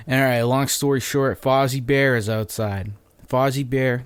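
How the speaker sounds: usual speaking voice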